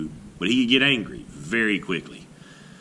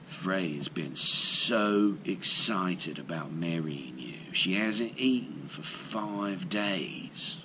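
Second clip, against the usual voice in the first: softer, slow confiding whisper; 4 semitones lower, 6 dB quieter, slower than usual. A narrator's reading. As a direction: lean in and whisper the excuse. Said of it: "He whispered to Thrym".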